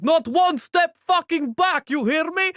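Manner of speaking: high, loud, slow threat to the troops; shouting commissar. Retold or imitated. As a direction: bark it like a commissar holding the line. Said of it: "He's like".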